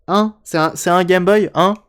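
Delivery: loud, high mock disbelief; exaggerated shocked question; incredulous.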